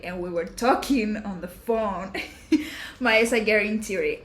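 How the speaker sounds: ordinary talk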